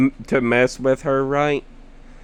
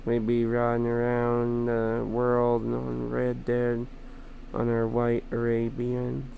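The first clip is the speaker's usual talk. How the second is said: soft musing; 11 dB quieter than usual. Real, not acted. Dreamy musing about Nighthawk.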